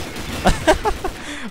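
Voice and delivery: high-pitched falling laugh; giggle. Real, not acted. Laughs after complaining about game.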